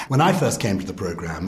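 ordinary talk, nothing marked

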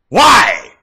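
high, loud single cry; loud, high plea; shouted demand. Real, not acted. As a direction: call out to the heavens. Shouts at the Lord.

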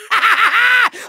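high villain's cackle; gleeful evil laugh. Acted. Villain enjoying the Skylander's damage, gleeful cackle. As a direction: cackle with relish. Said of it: "it feels good to laugh".

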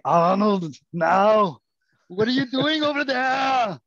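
put-on high, loud action-hero accent; Arnold Schwarzenegger voice. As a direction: shout it like a bewildered action star. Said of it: "you go to the Arnold voice".

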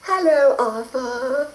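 high sing-song hello; trilling. A narrator's reading. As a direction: trill it like a fairy. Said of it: "trilled the fairy".

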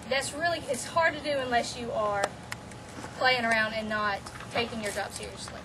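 ordinary talk

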